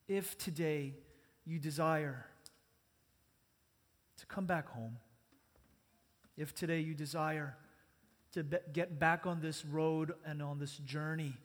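quiet, measured prayer for others to repeat. Acted. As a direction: lead the congregation gently in prayer. Leads a prayer.

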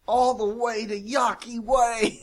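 high, slow mock voice; silly put-on voice. Joking with co-hosts in a put-on voice. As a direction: stretch it out in a goofy voice. Funny quip in odd voice.

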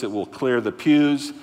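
ordinary talk, nothing marked

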